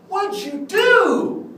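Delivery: very loud, high, drawn-out yell; imitated yell. Clown lecturer accusing the kid who broke it, yelled. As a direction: bellow it, stretching the words out high. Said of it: "Why are you yelling at me".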